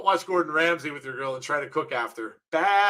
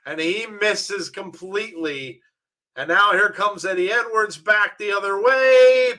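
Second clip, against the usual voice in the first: wrestling commentator; 3 semitones higher, 3 dB louder, slower than usual. Acted. Calls the wrestling match live.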